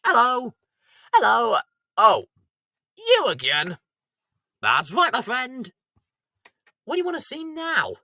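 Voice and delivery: a hint of a chatty cashier; cinema clerk. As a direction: play the weary box-office clerk. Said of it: "Imagine this scene".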